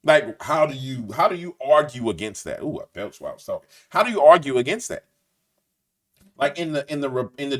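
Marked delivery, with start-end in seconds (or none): none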